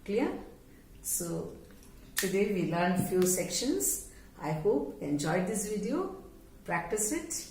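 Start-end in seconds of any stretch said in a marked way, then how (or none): none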